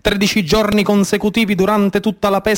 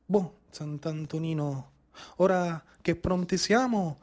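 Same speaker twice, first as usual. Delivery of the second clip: very low and much softer, murmured litany; whispered prayer; 4 semitones lower, 7 dB quieter than usual. Acted. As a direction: pray to the saint under your breath. Fishermen's prayer to the saint. (preghiera mormorata, molto bassa e piano)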